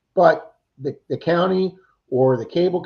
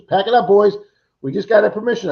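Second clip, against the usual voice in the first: put-on higher and louder, calling the boys to pack up; rally the crew with the news; eager announcement; 7 semitones higher, 5 dB louder than usual. [name] calls over to the boys, excited and loud.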